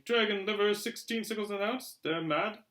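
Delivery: high, loud grumbling woman; indignant shopper. A narrator's reading. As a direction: scoff at the prices. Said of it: "shaking her head".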